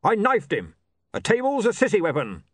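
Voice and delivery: high, loud indignant correction; aggrieved barbarian. Acted. Barbarian correcting the newspaper report, aggrieved. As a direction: set the record straight, offended. Breezok's figure voice.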